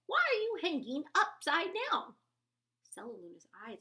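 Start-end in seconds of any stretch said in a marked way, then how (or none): 0.1-2.1 high, loud questioning call. A narrator's reading. Bat questions the upside-down newcomer, loud and high. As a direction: boom it out, puzzled. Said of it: "a loud voice said".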